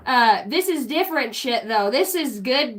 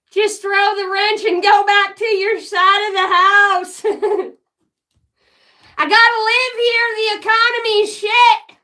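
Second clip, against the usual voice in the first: mock yell; 7 semitones higher, 6 dB louder than usual. Acted shout at Karen.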